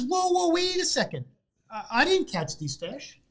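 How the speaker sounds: high, loud panicked excuse; alarmed protest. Acted. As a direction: blurt it out, caught red-handed. Caught fisherman protesting.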